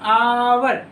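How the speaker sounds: आवर loud, with a long opening आ; teacher's emphatic, drawn-out आवर; sounded-out word. Shown as a demonstration. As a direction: stretch the आ in आवर for learners. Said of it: "आर है ना बच्चों".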